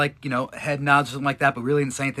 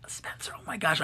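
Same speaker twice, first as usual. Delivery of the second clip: hushed, whispered fan greeting; excited whisper; 3 semitones higher, 9 dB quieter than usual. Retold or imitated. Spotting Spencer during a live stream, retold excited whisper. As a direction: whisper it urgently, as if mid-broadcast.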